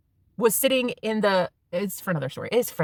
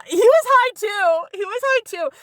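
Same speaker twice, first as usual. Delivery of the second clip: high, slow and loud; gleeful emphasis; excited; 12 semitones higher, 6 dB louder, slower than usual. Retold or imitated. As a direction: lean into the surprise. Excited repeat about boyfriend.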